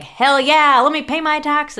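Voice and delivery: loud, high hammed-up enthusiasm; mock excited. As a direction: cheer like paying taxes is fun. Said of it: "I don't think anybody gets excited about".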